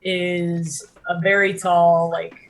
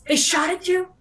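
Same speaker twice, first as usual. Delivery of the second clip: surprised; 13 semitones higher than usual. Acted. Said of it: "and he's like".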